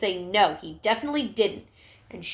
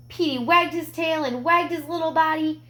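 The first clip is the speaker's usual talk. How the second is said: ordinary delivery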